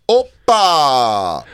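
put-on exclamation with a long held vowel; hammed-up drawn-out "oppa"; Giannis Papas. Host doing his own impression in reply, Giannis Papas. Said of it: "do my Giannis Papas".

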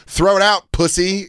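high, loud taunting yell; taunting the roommate with a loud mocking yell. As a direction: jeer at him at full volume. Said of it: "and I was like".

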